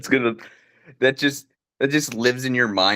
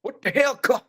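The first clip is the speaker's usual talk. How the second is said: grandpa yell; 10 semitones higher than usual. Retold or imitated. Grandpa yelling bit.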